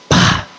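short, sharp vocal smack; sudden burst mimicking a slap; imitated slap. Father slaps his son to change the channel, imitated smack. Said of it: "slap me on the head".

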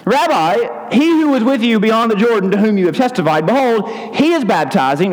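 high, urgent complainers to a rabbi; John's questioners. Acted. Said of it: "They came to John and said to him".

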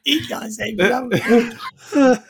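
slow words through laughter; laughing. Real, not acted. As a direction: talk while cracking up. Laughing comment.

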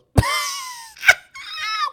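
loud high cackling laugh; imitating the man laughing at her; mock burst of laughter. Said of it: "And he goes".

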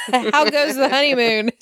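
high retold taunt through giggles; laughing. Recapper repeats Rafe's cell taunt, laughing. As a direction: giggle while repeating the tease.

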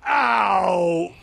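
hammy yelp of pain; playful ouch; mock pain cry. Voicing pain of character hit.

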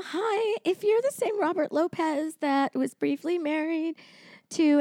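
high, slow, hesitant message; small high caller voice; role-played voicemail. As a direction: play a timid caller leaving a message. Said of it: "You're gonna leave your message".

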